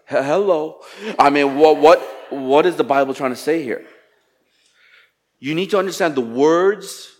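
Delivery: ordinary talk, nothing marked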